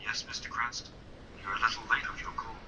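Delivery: thin, quiet telephone voice; faint phone-filtered villain; over the phone. Acted. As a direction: sound like a voice down the line.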